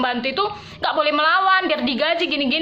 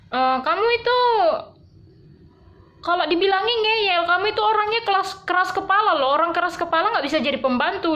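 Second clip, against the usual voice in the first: higher lecturing rebuke; mock scolding boss; 5 semitones higher than usual. Boss telling her she is too stubborn, high rebuke.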